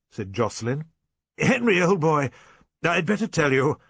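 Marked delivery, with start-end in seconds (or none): 1.4-3.8 higher, louder character voice; shaken squire. A narrator's reading. Squire begins breaking bad news to his son, shaken. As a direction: push it out, pitched up and effortful.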